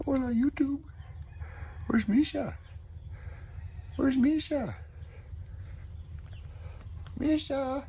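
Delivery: very high cooing pet voice; talking to the dog. Real, not acted. Greeting his sleepy dog Oso in a very high coo. Calling the dogs to greet viewers.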